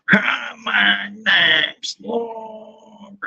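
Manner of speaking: slow drawn-out intro; character voice. Acted. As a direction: introduce her slowly, sweetly.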